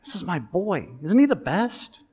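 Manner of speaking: a hint of a doting dad, lifted high; proud dad. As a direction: brag about your boy like a thrilled father. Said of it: "would you love to hear that from your dad".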